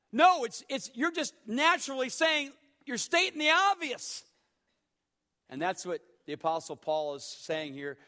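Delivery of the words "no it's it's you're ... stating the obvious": loud, high, ringing pulpit proclamation; fiery revival preacher crying out; full-force preaching. Acted. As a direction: preach it out to the back row.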